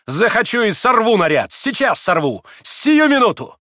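high, loud defiant cry; loud, ringing outburst; ringing shout. A narrator's reading. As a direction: cry it out ringingly, peaking at the end. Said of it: "звонко прокричала она".

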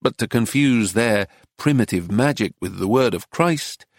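plain reading voice

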